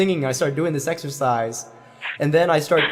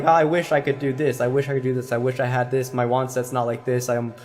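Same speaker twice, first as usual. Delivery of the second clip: worried student imitation; 4 semitones lower, faster than usual. Teacher imitating student's constant worrying, fast descending rattle. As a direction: reel off worries faster, fading down to a low murmur. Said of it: "you are always talking about".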